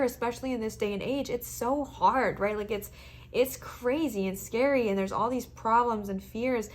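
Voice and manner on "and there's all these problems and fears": ordinary talk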